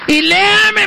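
mock demanding priest; insistent challenger. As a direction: push him to read it, voice up. Priest demanding he read aloud.

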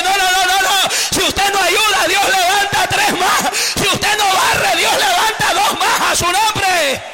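más agudo, lento y fuerte, con risa; predicación exaltada. Real, not acted. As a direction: remata el punto gritando entre risas. (predicador que grita y ríe)

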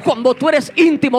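íntimo punched out loud at the peak of the phrase; loud preaching refrain. Acted. Preacher repeating his refrain to the crowd, loud and insistent. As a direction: belt out íntimo to the congregation.

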